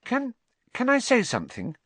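put-on high, careful girl's voice; timid schoolgirl; timid Hermione. A narrator's reading. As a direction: ask shyly for a turn. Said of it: "said Hermione timidly".

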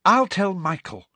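high, impatient offer. A narrator's reading. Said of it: "said Ginny impatiently".

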